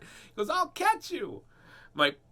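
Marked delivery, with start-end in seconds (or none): 0.5-1.4 put-on high little boy promising; imitating a young son; child voice. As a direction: reassure like a small kid. Son's voice retold.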